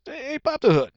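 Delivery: high-pitched eager request. Acted. Shopper delighted by new car, high eager request. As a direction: call it out eagerly, pitched right up.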